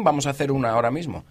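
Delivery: ordinary talk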